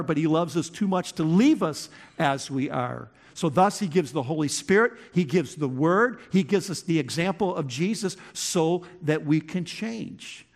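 usual speaking voice

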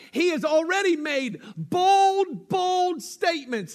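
high, loud, driving pulpit voice; full-force preaching. Acted. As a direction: preach it to the back row.

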